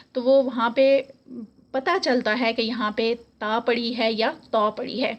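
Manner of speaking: ordinary talk, nothing marked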